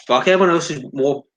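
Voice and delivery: normal talking voice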